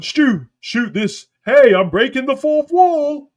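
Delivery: slow, hammed-up impression of a showboating wrestler; mocking Cena. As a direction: drag it out high and loud like a smug wrestler. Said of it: "he calls over Stu".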